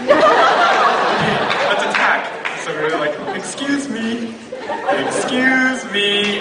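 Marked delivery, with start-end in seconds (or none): 0.1-2.2 high, slow, laughing "let's attack"; mock battle cry. Playful quote to Hannah. 3.4-6.4 high, slow sing-song "excuse me"; sing-song call. Retold or imitated. Calling out to stranger.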